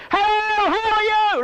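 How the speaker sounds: high, loud call across the pool; calling out cheerily to someone far off; loud greeting. Retold or imitated. Swimming lady calls across the pool to a lifeguard, loud high greeting. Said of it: "and she goes".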